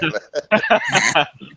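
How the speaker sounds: high laugh. Real, not acted. Laughing at own joke.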